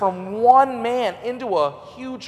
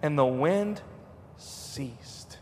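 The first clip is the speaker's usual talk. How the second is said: hushed; 5 semitones lower, 9 dB quieter, much slower than usual. Real, not acted. Dramatic hush in sermon.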